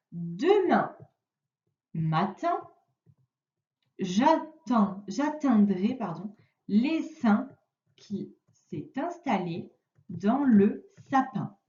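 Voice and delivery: teacher reading a sentence out piece by piece; halting, spaced-out reading for a pupil to follow; slow dictation-style reading. Shown as a demonstration. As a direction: read slowly, word group by word group, with long pauses. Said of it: "Je te la lis".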